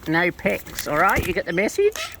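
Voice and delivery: usual speaking voice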